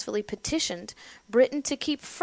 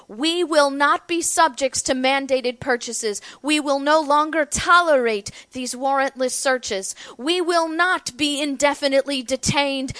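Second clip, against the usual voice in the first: loud and clear; 5 semitones higher, 9 dB louder than usual. Retold or imitated. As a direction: proclaim it at full voice to the crown. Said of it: "saying in a loud and clear voice".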